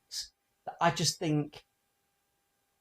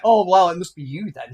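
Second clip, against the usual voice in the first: loud accusation; 2 semitones higher, 11 dB louder than usual. Retold or imitated. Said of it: "And I'm like".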